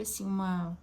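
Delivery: ordinary talk, nothing marked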